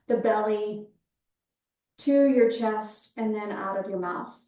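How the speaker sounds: calm breath-awareness cueing, easing down at phrase ends; guided meditation. Real, not acted. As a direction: guide them gently into the breath, leaving space after each phrase.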